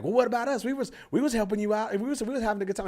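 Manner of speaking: high-pitched mock complaint; mad audience couple. Heckling couple to comic, feeling left out: high mock indignation. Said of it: "This other couple got mad at me".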